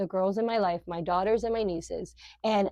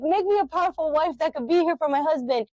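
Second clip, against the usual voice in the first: high, louder mock pleading to God; mock eager prayer; 8 semitones higher, 4 dB louder than usual. Speaker mocking her own prayer at the conference, hammed-up pleading. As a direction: ham up your own eager asking.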